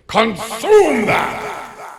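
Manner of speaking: high, slow, booming yell; yelling command. Retold or imitated. Priest yells at her in front of the congregation, booming command. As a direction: shout it before the whole congregation. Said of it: "He then again yells".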